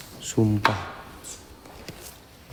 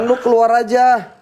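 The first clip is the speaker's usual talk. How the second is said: higher, daring call into the dark; 7 semitones higher than usual. Real, not acted. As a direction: taunt the hidden ghost. Challenging ghost to come out. (berseru menantang setan)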